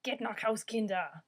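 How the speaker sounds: loud barked order, Geht nach Haus, Kinder!; angry German command shouted at kids. Shown as a demonstration. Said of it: "I want to say them angrily".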